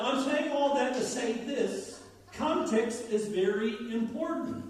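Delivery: ordinary talk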